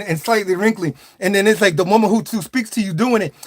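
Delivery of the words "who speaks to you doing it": ordinary talk, nothing marked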